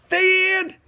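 high, bright announcement; cheerful finale. Acted. Dad finishing the story for his son, high and cheerful. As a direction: close the story with a flourish.

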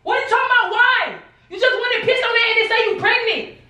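high, heated, rapid accusing; furious rant. Real, not acted. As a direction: fire the questions off in disbelief. Furious over supposed cheating.

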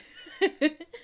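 high, loud burst of laughter; emotional laugh. Real, not acted. Player about to cry over old games, laughing it off.

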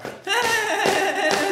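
sudden high, loud laugh. Real, not acted. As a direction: burst out laughing. Storyteller laughs before horse speaks.

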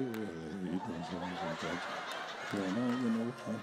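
very low, soft, mumbling voice; mock meek murmur, barely audible; timid low-energy person. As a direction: mumble like someone with no energy at all. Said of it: "la gente que te dicen".

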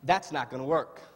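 normal talking voice

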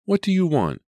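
high, loud, panicked demand; trying to hide fright. A narrator's reading. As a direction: challenge the caller but let fear show. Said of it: "She tried not to sound frightened".

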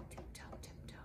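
very soft, secretive whisper; tiptoeing whisper. Shown as a demonstration. Acting out walking on tiptoes.

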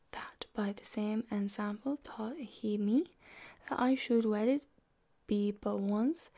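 whispered Middle English reading; library whisper. Acted. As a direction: read the old verse in a hushed whisper. Reads Chaucer aloud in whisper.

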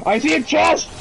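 high excited callout. Real, not acted. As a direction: shout it out thrilled.

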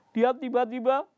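plain reading voice

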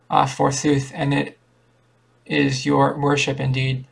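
ordinary delivery

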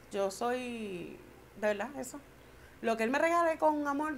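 ordinary talk

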